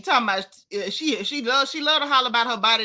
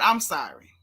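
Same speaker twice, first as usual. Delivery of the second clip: usual speaking voice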